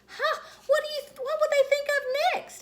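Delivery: very high, loud squeal of delight; delighted kid. Acted. Junie B discovering the seat tray, squealing with delight. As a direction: gush over the tiny tray.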